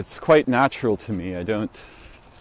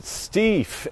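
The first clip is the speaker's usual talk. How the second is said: disgusted exclamation; 12 semitones higher than usual. Retold or imitated. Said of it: "And I said".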